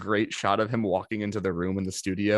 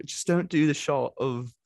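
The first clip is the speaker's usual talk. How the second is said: lightly put-on, slow impression; a first stab at mimicking him, drawn out and higher; Chris; 5 semitones higher, slower than usual. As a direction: imitate him with slow, raised pitch. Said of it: "let me do my real Chris impression".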